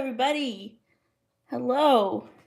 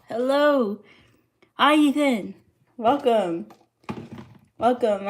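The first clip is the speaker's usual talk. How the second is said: excited greeting; 2 semitones higher, 6 dB louder, slower than usual. Real, not acted. Greeting a viewer joining the stream.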